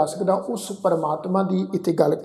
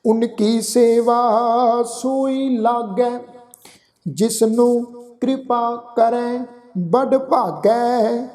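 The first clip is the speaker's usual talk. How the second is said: slow, drawn-out sacred recitation; scripture recitation; 3 semitones higher, much slower than usual. Acted. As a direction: recite the verse slowly, stretching the vowels.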